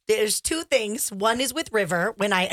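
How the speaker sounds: ordinary delivery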